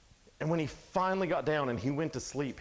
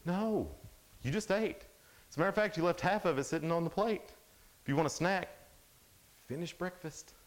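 higher-pitched parental refusal; put-upon parent, pitched up; exasperated parent; 6 semitones higher than usual. Acted. Parent answering a child begging for a snack after breakfast, exasperated.

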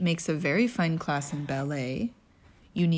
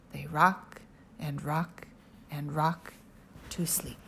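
slow lulling; 4 semitones lower, 5 dB quieter, slower than usual. Acted. Lulling to sleep.

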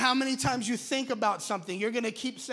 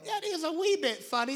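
British cartoon accent; 5 semitones higher than usual. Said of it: "it's like".

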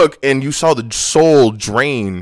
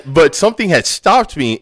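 normal talking voice

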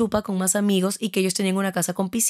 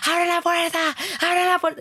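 high-pitched held-back yell; reenacted panicked shouting, pitched way up; retold frantic scream; 9 semitones higher than usual. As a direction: cry to be let out, mimicking a scream at modest volume. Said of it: "comencé a gritar".

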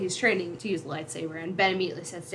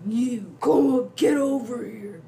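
loud, higher, drawn-out mock summons; tractor beam voice; 5 semitones higher, 7 dB louder, slower than usual. Voicing a tractor beam pulling the ship, loud drawn-out beckoning. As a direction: haul them in with every slow word.

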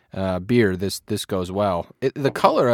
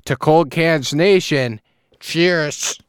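put-on slow, loud Wisconsin accent toast; 6 semitones higher, 5 dB louder, much slower than usual. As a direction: cheer it in broad Wisconsin accent. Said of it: "I don't like my, uh, Wisconsin accent".

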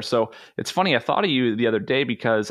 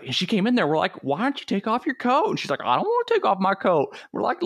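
put-on thick Southern accent; 9 semitones higher than usual. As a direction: imitate a friend's thick, dumb-sounding Southern accent. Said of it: "he's got real thick, you know, Southern accent".

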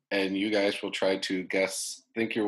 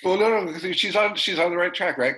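excited encouragement; 9 semitones higher, 5 dB louder than usual. Real, not acted. Excited hint to guesser.

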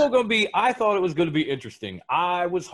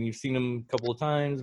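usual speaking voice

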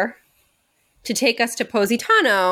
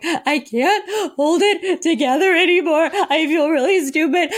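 laughing through tears; 5 semitones higher than usual. Retold or imitated. Retold crying confession to boyfriend.

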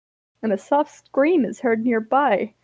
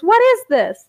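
loud, high, indignant question; acted outburst, pitched way up; scowling demand; 9 semitones higher, 6 dB louder than usual. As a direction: snap it out in alarm. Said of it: "Grape scowls at Shatton and says".

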